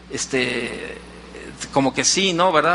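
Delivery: imitated halting, low reply from a boy; hesitant child. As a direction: answer unsurely, in low broken bits. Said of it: "el niño titubeante decía". (respuesta titubeante, imitando a un niño)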